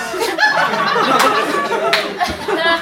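high burst of real laughter; helpless high laugh. Real, not acted. Laughing at his own story.

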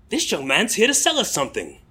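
put-on an American putting on a British accent as the old barber; imitating an English pensioner with a British accent; British accent attempt. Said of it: "my attempts to do the accent".

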